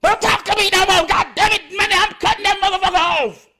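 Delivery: mock panic, backing off in a hurry; panicked quote. Imitating a scared person who won't be turned on. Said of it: "And they say".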